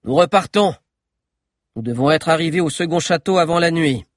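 rally the group with firm authority; forceful, high-pitched order to move out; loud, authoritative. A narrator's reading. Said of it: "d'une voix forte qui avait retrouvé toute son autorité".

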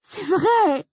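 high, loud, desperate insistence. Acted. Grace desperate before the staring staff. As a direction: plead in despair to be believed. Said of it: "ai-je insisté au désespoir".

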